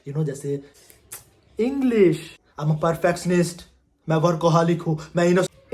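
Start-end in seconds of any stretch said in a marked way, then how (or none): 1.6-2.4 higher, insistent cut-in; interrupting interviewer. Acted. Interviewer demanding English from the candidate, insistent. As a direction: play an interviewer butting in.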